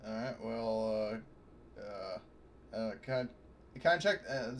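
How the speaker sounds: ordinary delivery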